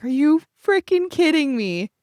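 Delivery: can't believe your luck, voice leaping up; thrilled disbelief. Retold or imitated. Photographer seeing she got the shot, retold amazed disbelief.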